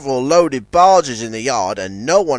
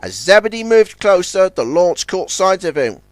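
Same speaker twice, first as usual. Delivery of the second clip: plain reading voice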